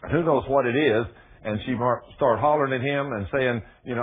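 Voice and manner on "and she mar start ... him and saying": ordinary delivery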